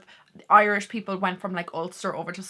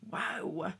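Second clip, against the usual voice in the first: ordinary delivery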